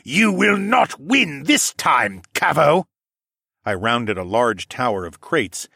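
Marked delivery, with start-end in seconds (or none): 0.0-2.9 slow, loud, high declaration; enraged roar. A narrator's reading. Attacker defying his foe mid-fight, an enraged slow roar. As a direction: roar each word in fury.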